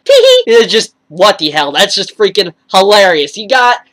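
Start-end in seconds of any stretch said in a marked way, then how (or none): 0.0-0.5 very high, bright, coy little giggle; cutesy giggle. Retold or imitated. Petra messing up her lines, very high coy giggle. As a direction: giggle it off like a shy girl caught messing up. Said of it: "she's like".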